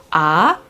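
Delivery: "ah" rising like a question. Shown as a demonstration. Teacher modelling tone two, "ah" climbing up the scale. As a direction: slide "ah" upward, as if going upstairs. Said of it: "deemed as the question tone".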